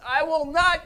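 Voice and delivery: high, loud preaching shout; emphatic divine declaration. Acted. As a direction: declare it forcefully as God's word. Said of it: "God says".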